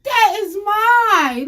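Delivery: high, slow, loud yell; shout. Pretend. Comic outraged at the crowd, loud yell. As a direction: explode on that.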